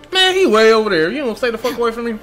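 ordinary delivery

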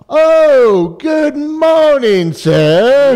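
high, slow, loud overly polite greeting; polite phone voice. Retold or imitated. Producer showing how he answers a call, prim polite voice. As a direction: put on your politest phone manners.

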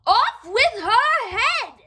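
high, loud, slow furious queen; Queen of Hearts raging at the gardeners; yelling Queen of Hearts. Acted. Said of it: "what she yelled next".

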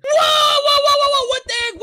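loud, high burst of alarm; startled rapid-fire yelps, high and loud; startled shouting. Acted. Player spots birds falling from the sky, startled shouting.

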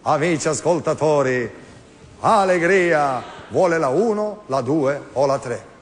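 stretched, drawn-out TV host catchphrases; slow showman's quiz-host patter; slow quiz-host impression of Mike Bongiorno. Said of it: "Fammi Mike Bongiorno".

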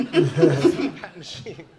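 slow, loud deadpan repeat; dramatic emphasis. Retold or imitated. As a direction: drag it out for the joke.

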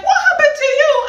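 high, loud shocked shriek; screamed. Retold or imitated. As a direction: shriek it at a long-lost friend. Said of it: "we screamed".

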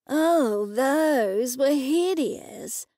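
very slow, drawn-out scorn; drawling disdain. A narrator's reading. Daughter agreeing mother's cat figurines are ugly, drawling disdain. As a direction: draw out the disgust on every word.

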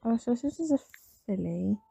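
ordinary talk

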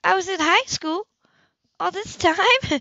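high, loud, stretched-out delight; thrilled disbelief. A narrator's reading. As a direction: burst with joyful surprise. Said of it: "could have leaped up in bed and danced".

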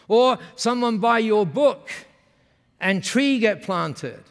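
slow, emphatic Japanese-English imitation; Masami's Japanese-accented English. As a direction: proudly spell it out in Japanese broken English. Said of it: "when they speak like that".

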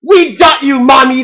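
high, loud prankster glee; shouted. A narrator's reading. Ducklings revealing the prank to mother, shouting. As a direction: shout it triumphantly at your victim. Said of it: "they shouted".

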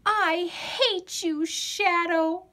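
high, slow angry cry; child yelling at her shadow; yelled. A narrator's reading. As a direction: shout it at the shadow, hurt and furious. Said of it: "yelled Hortense".

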